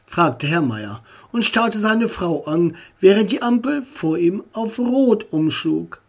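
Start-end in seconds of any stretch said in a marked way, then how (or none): none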